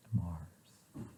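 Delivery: breathy whisper of a single word; whispered caption. Acted. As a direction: whisper the name like a secret. Reading picture captions aloud softly.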